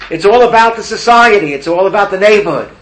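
loud and high, blaming the world; indignant excuse. Acted. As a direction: insist it's everyone's fault but his. Blaming society, acted plea.

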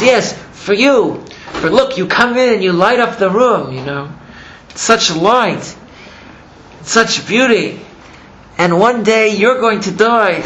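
retold mourning at full emotional pitch; impassioned lament. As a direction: cry out in grief, stretching key words. Acted lament over beauty doomed to die.

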